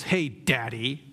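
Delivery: lightly voiced son, drawn out with a rising end; slow, teasing call to a father; estranged son calling Dad. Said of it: "he finally runs into his dad".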